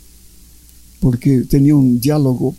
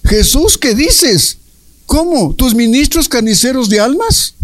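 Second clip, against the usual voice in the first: much higher, startled, disbelieving cry; alarmed Luisa, pitch leaping up in horror; aghast Luisa; 8 semitones higher than usual. Retold or imitated. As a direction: recoil in shock at what Jesus said.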